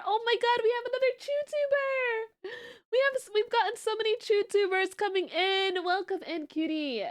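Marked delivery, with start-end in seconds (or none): 0.0-4.9 excited squeal. Real, not acted. Streamer greeting a new viewer.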